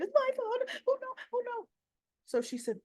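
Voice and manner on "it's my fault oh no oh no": put-on much higher, whiny wailing girl; mock panic. Imitating Lucy panicking at the hospital, high and whiny. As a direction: wail it like a panicking teen. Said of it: "Lucy said".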